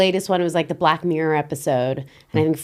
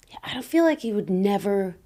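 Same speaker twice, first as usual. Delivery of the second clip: ordinary delivery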